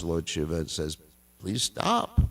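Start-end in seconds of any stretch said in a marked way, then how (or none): 1.4-2.2 Parvati's upset plea, pitched much higher. A narrator's reading. Parvati begs Shiva to stop his dance, upset and much higher. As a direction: cry out to stop him, pitched up high. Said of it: "she gets very upset".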